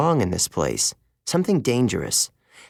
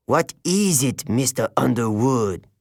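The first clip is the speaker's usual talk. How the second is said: a hint of French disdain for Grover; contemptuous. A narrator's reading. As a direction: sneer at the student. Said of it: "His tone made it clear that he detested Grover".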